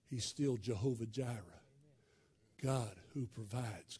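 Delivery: low, soft and tender; God's soft voice. Acted. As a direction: speak it gently, as God's quiet nudge. God speaks softly to believer.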